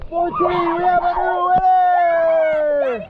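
slow, high, loud winner announcement; excited shout. Real, not acted. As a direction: call it out like a game-show host.

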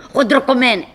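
normal talking voice

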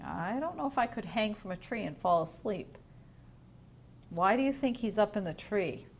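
ordinary delivery

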